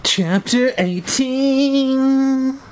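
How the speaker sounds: high, long-drawn chapter title; chapter announcement. A narrator's reading. Reader announcing the next chapter, drawn out and high. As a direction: sing out the heading, last word stretched.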